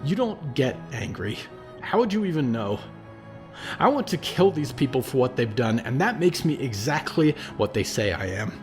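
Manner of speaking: put-on a raging boy begging to be calmer; anguished anger. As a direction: spit out the helplessness. Percy's anguished angry outburst.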